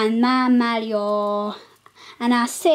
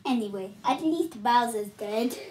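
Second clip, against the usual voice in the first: usual speaking voice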